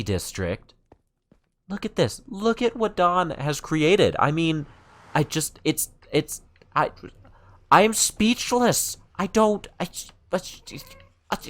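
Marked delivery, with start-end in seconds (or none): 1.7-11.4 much higher, stammering in amazement; lost for words at the sight; speechless awe. Real, not acted. Showing viewers what Dawn built, high and speechless. Stunned by Dawn's fantasy district.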